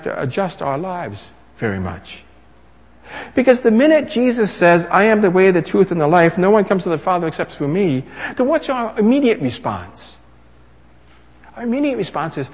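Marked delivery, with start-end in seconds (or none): 3.3-12.5 loud, high pulpit proclamation; full-force preaching. Acted. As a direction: proclaim it to the whole church.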